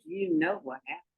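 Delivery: ordinary delivery, nothing marked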